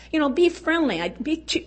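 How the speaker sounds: ordinary talk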